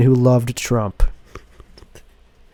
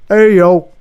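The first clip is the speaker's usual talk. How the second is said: higher, louder street call; 6 semitones higher, 7 dB louder than usual. Acted. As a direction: shout to get his attention. Stranger calling out to Tim.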